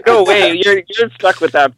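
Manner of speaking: loud, gleeful no way; excited outburst. Real, not acted. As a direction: shout it as a playful refusal. Teasing friend about stuck flair.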